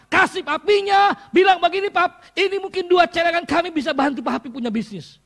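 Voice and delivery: loud, rising fervent preaching; preaching at full force. Acted. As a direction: build the story up loud for the congregation. (khotbah berapi-api, makin keras)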